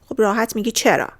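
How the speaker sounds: usual speaking voice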